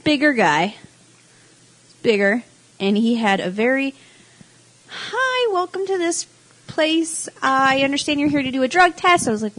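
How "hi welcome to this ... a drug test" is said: high voice. Retold or imitated. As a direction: squeak out a welcome like a high-voiced receptionist. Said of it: "what kind of voice someone's gonna have".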